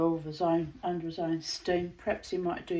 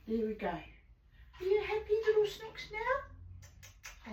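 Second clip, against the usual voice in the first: cooing pet talk; 10 semitones higher than usual. Real, not acted. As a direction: coo tenderly at the bird. Cooing to the bird.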